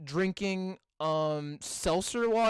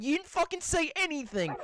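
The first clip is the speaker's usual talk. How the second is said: normal talking voice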